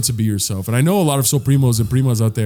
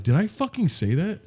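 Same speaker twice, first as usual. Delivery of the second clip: mock-indignant question, pitched up without shouting; indignant retort; 5 semitones higher, 4 dB quieter than usual. Professor cussing at a student who misunderstood her, indignant retort. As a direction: imitate a professor's incredulous pushback. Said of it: "she cussed at me".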